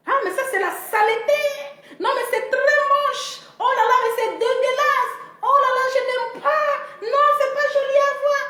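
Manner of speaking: high, put-on voice of prissy disgust; high mocking voice of disgusted locals. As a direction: squeal your disgust up high.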